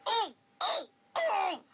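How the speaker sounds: high, loud cries of pain; pained cry. Acted. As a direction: yelp as stones hit. Stones hit Henry.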